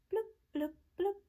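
high bubbly fish bloops. Acted. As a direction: pop out silly fish sounds. Fish sound.

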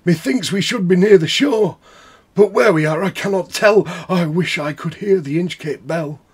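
a frightened sailor straining to hear; anxious sailor. Acted. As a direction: call out uneasily into the dark. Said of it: "said one".

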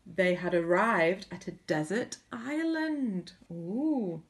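ordinary delivery, nothing marked

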